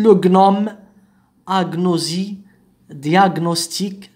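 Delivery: hard g then n in غنوم, أغنوزي, دياغنوستيك; separate g-n. Shown as a demonstration. Teacher lists the exceptions, g and n sounded separately. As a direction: list غنوم, أغنوزي, دياغنوستيك, never merging g-n into "ny". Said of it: "لا تنطق ني".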